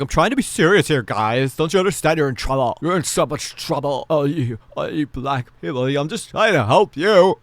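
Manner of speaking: imitating a flustered, earnest scolder; mock pleading. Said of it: "Alex was like".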